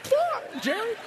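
very high, strained Kramer fine; Kramer impression. Kramer snapping at Jerry, very high squealed fine. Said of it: "I felt like Kramer".

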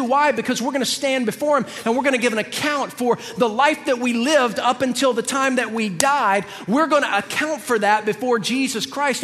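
high-pitched, driving sermon delivery; forceful preaching. Real, not acted. Preacher warning believers they will give account, forceful preaching. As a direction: preach it with urgency, leaning on key words.

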